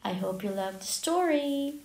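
plain reading voice

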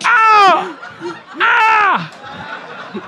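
high yelping cries of pain; mock pain yelps. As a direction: yelp like you hurt your leg. Jumped net, hurt leg.

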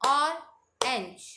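Orange in two slow, separated beats; two-beat syllables. Shown as a demonstration. Teacher leading two-clap drill, orange split in two. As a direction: leave a clear gap inside orange. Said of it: "हमें टू times clap करनी है".

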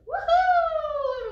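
very high, loud, long woohoo; celebrating whoop. Real, not acted. Storyteller celebrates Teresa's win with children, high joyful whoop. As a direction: whoop it up for the winner.

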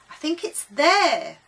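loud, high, slow call; eager sighting. A narrator's reading. Friend answers, pointing out ship.

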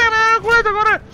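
high, loud yelp of alarm; alarmed yell. Pretend. Player hit from behind yells to run, alarmed yell. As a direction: shout it high and frantic.

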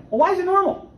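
high, loud, incredulous questions; indignant challenge. Real, not acted. Preacher to the congregation, rejecting relapse as normal, loud and high. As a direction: fire back at the idea that relapse is normal.

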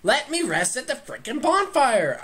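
frustrated shout at the game; frustrated yell. Real, not acted. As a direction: let it burst out, exasperated.

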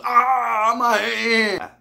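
high, loud cry of pain; pained old man scream. Retold or imitated. Narrator imitating the old man yanked by handcuffs, screaming. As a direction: shriek like your hand just got yanked.

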